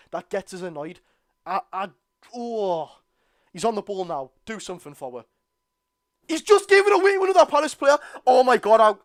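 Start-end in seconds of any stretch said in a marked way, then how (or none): 6.3-9.0 outraged scream, much higher and louder; furious shout. Real, not acted. Fan screaming as Barnes loses the ball, furious shout. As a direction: roar of disbelief at the giveaway. Winger gives ball away.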